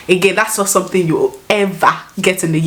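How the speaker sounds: ordinary delivery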